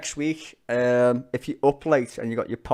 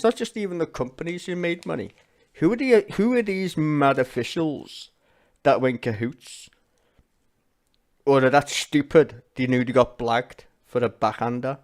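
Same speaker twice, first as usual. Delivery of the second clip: angry rant; 3 semitones higher, 6 dB louder than usual. Real, not acted. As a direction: let the outrage climb on these officials. Mad rants.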